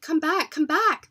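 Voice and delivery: loud, high calling out; shouting. Acted. Parents shouting into the woods, loud calling. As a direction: call them back at full voice. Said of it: "they shouted".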